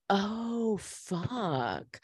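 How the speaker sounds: drawn-out, hushed reaction; mock dawning realisation. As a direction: let it sink in, dropping softly. Viewer's dawning realisation, retold.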